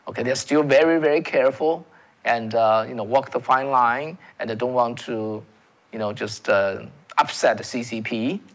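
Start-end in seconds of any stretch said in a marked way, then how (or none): none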